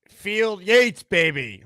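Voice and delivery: loud, high hype-man shout; Boy Green hype intro. Pretend. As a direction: belt it like a podcast hype intro. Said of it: "like Boy Green does in his intro".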